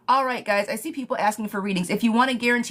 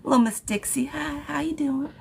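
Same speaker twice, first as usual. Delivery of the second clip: Southern Belle accent; 7 semitones higher than usual. Shown as a demonstration. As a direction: greet like a little Southern Belle. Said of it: "I can do a Southern Belle".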